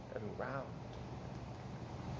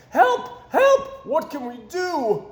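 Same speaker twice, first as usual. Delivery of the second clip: much higher, louder frantic shouts; panicked cry for help; 11 semitones higher, 6 dB louder, slower than usual. A narrator's reading. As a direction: scream for help as the shed burns. Townspeople panic at the fire.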